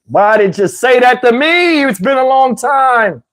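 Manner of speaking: high, slow, drawn-out mock wail; worked-up reaction. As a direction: cry out as if overcome by temptation. His worked-up reaction to her come-on.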